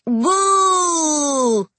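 long, high ghost wail, sliding down; eerie ghostly intoning. A narrator's reading. Children luring the soothsayer out, eerie ghostly wail. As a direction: hold it out like a spooky ghost noise. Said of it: "they intoned".

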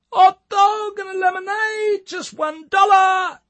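high, loud, slow megaphone yell; yelled sales call. A narrator's reading. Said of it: "yelled Tommy".

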